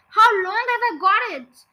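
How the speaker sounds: high, loud alarmed question; crying out. A narrator's reading. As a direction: cry out in dread for an answer. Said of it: "cried Missus Twit".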